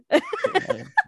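squealing high laugh. Real, not acted. Laughing at the quote.

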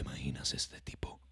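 low, soft breathy whisper; mock whisper. Imitating how Batman talks, in a whisper. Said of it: "te habla así".

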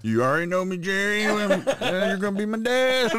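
high, loud, cocky teasing voice; high loud mock greeting to the future father-in-law; high mock boyfriend. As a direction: play the smug boyfriend to her dad. Mocking reply to father.

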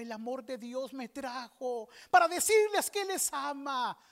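grito apasionado, agudo y fuerte; evangelista gritando. Retold or imitated. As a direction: shout it out like a fervent street evangelist. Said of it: "y les gritaba". (grito apasionado de evangelista)

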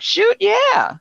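high-pitched eager yes, retold; eager acceptance. Accepting lunch at a new place, eager acceptance. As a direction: jump at the invitation.

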